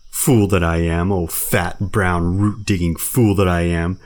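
loud, slow bear berating himself; self-reproach. Acted. Baloo scolding himself, slow loud self-reproach. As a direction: curse yourself like a clumsy bear.

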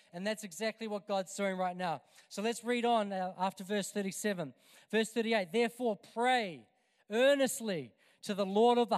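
loud, rising sermon delivery; full-force preaching. Acted. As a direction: preach it out with growing fire.

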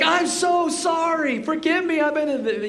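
high, drawn-out, gushing apology; mortified apology. Retold or imitated. Golfers who cursed before a pastor, hammed-up mortified apology. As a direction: imitate someone begging pardon in embarrassment.

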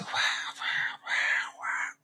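quiet, breathy mock sobs; imitated crying. Actor retelling his first acting-class tears, imitated crying. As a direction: mimic bawling in airy, voiceless wails. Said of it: "ahí empecé a llorar".

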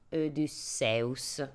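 'Odysseus' said very slowly, the Greek way with the U; Greek pronunciation. Shown as a demonstration. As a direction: sound out 'Odysseus' syllable by syllable. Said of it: "Odysseus probabilmente si pronuncia".

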